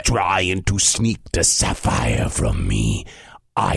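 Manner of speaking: deep, suspicious giant grumble; grumbling giant. Acted. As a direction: grumble very low like a huge brute. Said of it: "he grumbled".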